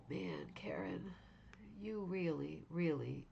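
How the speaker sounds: awed whisper. Real, not acted. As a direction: breathe it out in wonder. Awed reaction to the artwork.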